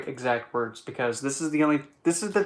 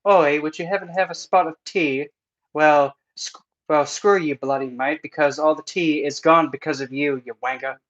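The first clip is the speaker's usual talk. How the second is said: loud mock cockney English; English accent; 2 semitones higher, 9 dB louder than usual. Joking about English heritage, loud mock British accent. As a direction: rant like a cheeky Brit. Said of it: "English is probably the one that has the most".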